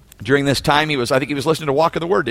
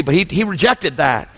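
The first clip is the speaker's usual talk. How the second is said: ordinary talk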